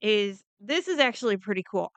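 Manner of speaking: ordinary delivery, nothing marked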